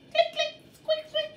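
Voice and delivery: high squeaky dolphin chatter; dolphin clicks and squeaks. Retold or imitated. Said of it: "it's more of a click, whistle, squeak".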